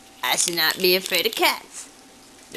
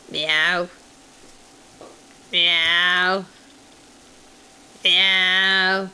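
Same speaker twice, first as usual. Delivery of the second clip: imitating a cat's drawn-out meow; 3 dB louder, much slower than usual. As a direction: meow like a cat to win it over. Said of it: "Do I sound like a cat".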